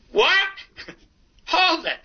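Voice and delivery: hollering. Retold or imitated. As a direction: yell to stop him mid-joke. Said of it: "And I holler".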